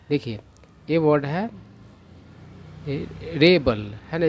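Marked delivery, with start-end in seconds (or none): 2.9-3.8 रेबल with the weight on रे, short ब; say REbel. Shown as a demonstration. Teacher modelling रेबल as the noun, stress up front. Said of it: "इसका उच्चारण कैसे होगा".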